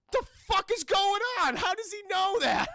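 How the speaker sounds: high, loud, baffled outburst; hammed-up villain's bewilderment; alarmed disbelief. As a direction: blurt it high in mock panic. Villain retold, alarmed behind closed door.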